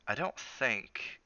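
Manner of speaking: very slow, drawn-out thought; lingering doubt. Acted. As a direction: stretch each word as doubt creeps in. Cook's doubt returns as he watches.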